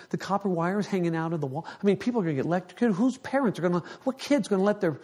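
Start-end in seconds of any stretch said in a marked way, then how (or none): 1.7-4.9 rising panic. Retold or imitated. Retelling his panic.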